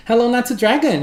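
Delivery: normal talking voice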